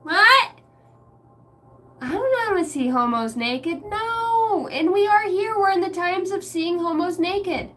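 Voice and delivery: high, loud mock rant; a mock sassy rant, pitched high; put-on character voice.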